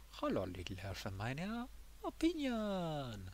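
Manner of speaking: lightly put-on, soft silly voice with swooping pitch; playful greeting. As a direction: coo a silly hello to the game.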